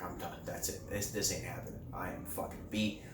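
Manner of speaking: whispered exhaustion from running in the heat; out of breath. Real, not acted. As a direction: gasp it out, spent. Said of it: "I'm out of breath".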